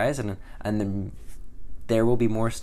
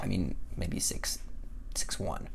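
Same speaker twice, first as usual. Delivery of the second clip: quiet aside; 3 semitones lower, 10 dB quieter, slower than usual. Real, not acted. Quick correction aside.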